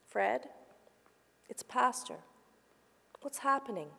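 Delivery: soft, gentle pastor's voice; calm a frightened old man tenderly; calm and loving. A narrator's reading. Pastor soothes distressed old Fred, softly calm and loving. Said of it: "said in a calm and loving voice".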